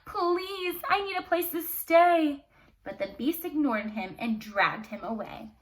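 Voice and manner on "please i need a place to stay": pleading. Acted. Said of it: "Maurice pleaded with him".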